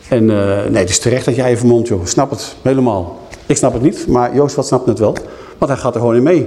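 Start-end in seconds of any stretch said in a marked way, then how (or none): none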